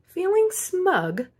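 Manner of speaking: high, slow and self-satisfied; slow high preening tone; smug. A narrator's reading. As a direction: savour it like a smug little cookie. Narrator voices smugness.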